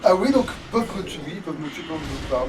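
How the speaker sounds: usual speaking voice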